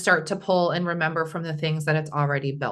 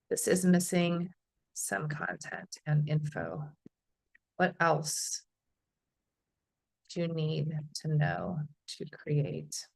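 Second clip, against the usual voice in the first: reading a prompt aloud word by word, slow and trailing softer; slow dictation; 3 semitones lower, 8 dB quieter, slower than usual. Shown as a demonstration. Host reads her AI prompt to the class, slow dictating voice. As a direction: spell it out slowly as you type it.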